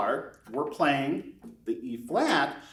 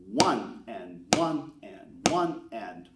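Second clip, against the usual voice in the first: slow, even counting with the foot tap; slow counted beat; 7 semitones lower, slower than usual. Shown as a demonstration. Teacher counting a slow beat for beginners. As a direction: count it out slowly for the students. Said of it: "like this".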